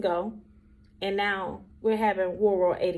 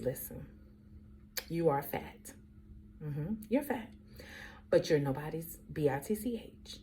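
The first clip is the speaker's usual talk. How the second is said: quiet, measured retold mom talk; blunt mother; 2 semitones lower, 9 dB quieter than usual. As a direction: lay it down softly, with deliberate pauses. Mother gives blunt pep talk.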